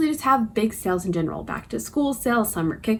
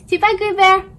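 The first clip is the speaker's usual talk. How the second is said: put-on very high cooing baby talk; puppy voice; 14 semitones higher, 5 dB louder, slower than usual. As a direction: coo at the dog. Talking to the dog again.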